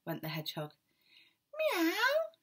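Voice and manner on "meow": very high, louder yowling meow; the cats on the wall yowling, very high. A narrator's reading. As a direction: yowl like a cat on the wall. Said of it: "sang the cats on the wall".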